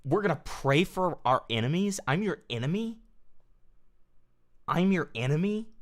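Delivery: questioning, pitch lifting in disbelief; incredulous echo. Real, not acted. Streamer repeating his critic's talk of enemies, incredulous. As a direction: throw the words back as disbelieving questions.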